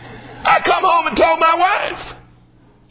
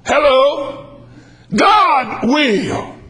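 normal talking voice

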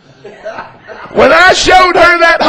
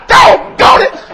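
high-pitched mock exasperation; comic frustration; 4 dB louder, slower than usual. Husband loses his hope of keeping the bill, comically frustrated. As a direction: yelp it in thwarted frustration, voice shooting up.

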